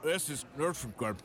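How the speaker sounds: talking with a mouthful of food; words round a bite of steak; mouth full. Real, not acted. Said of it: "don't talk with your mouth full".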